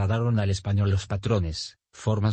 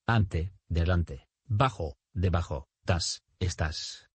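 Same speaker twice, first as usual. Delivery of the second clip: ordinary talk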